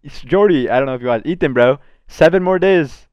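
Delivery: mock Ethan's hyped voice, stretching the first call; imitating Ethan. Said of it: "He always talks like that".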